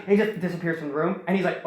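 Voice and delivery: normal talking voice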